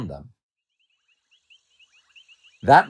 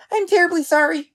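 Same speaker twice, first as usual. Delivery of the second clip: tiny, very high apologetic squeak; squeaky mouse. A narrator's reading. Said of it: "squeaked the mouse".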